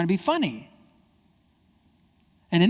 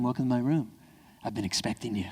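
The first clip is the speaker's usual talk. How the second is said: low, soft villain welcome; calm, hushed put-on welcome; mock sinister host; 4 semitones lower, 5 dB quieter than usual. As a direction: greet the intruder like a waiting villain.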